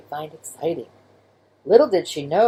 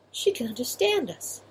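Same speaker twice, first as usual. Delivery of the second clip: mouse voice; 7 semitones higher, 5 dB quieter than usual. Acted.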